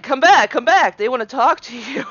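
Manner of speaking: acted-out urgent call, easing off at the end; calling him back. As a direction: call after him as in the story. Calling husband back in retold scene.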